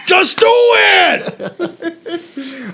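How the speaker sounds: high, very drawn-out yell; shouted impression. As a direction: stretch it into a hammed-up shout. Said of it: "impression performed on request".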